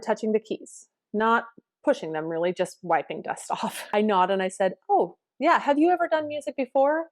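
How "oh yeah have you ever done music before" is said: higher, lightly interested tone; curious question. Retold or imitated. Teacher drawing out a nervous new student, curious question. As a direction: ask with raised, friendly curiosity.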